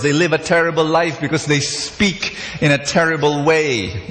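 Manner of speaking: ordinary talk, nothing marked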